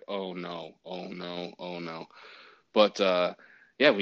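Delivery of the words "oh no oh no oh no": soft, low, dread-filled murmur under the breath; quietly panicking, hoping no one notices; holding breath. Retold or imitated. Umpire watching the review video in dread, soft low murmur. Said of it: "holding my breath".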